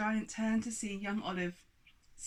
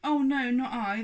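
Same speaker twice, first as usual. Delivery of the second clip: denying newt; 5 semitones higher, 9 dB louder than usual. Acted. Newt denies.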